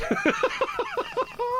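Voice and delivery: very high giggling laugh; amused cackle. Real, not acted. Host ribbing Johnny to the guest, high cackle. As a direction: cackle high at a friend's expense.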